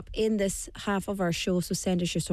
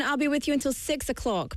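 ordinary talk

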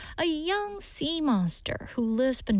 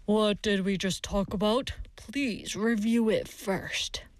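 lightly voiced boss, slowing at the end; measured, mildly reproving workplace reminder; firm boss; 3 semitones lower than usual. Boss to employee who would send an unchecked file, mildly firm.

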